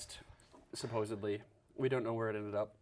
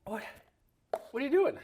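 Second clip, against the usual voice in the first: surprised; 12 semitones higher, 4 dB louder than usual. Real, not acted. Partner drinks his backwash.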